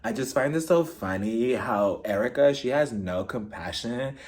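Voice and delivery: imitated low, deliberate complaint; Crystal impression. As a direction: drop it deep and drag it out quietly. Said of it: "Crystal is just like".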